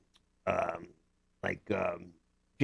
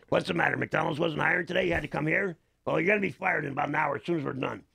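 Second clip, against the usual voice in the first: Seymour Lustig voice; 6 semitones higher than usual. Acted. Actor teasing movie extras on set, cocky Seymour voice. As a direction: sneer at the extras like a cranky old boss. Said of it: "stay in character".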